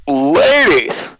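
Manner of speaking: loud, slow showman welcome; announcer voice. Pretend. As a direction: open the show like a game-show host. Podcast intro hosts open the show.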